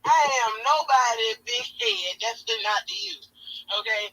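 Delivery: loud insistence. Real, not acted. Telling her friend off about the nickname, loud. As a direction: put your foot down loudly. Insists she is no big head.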